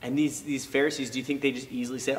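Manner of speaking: ordinary talk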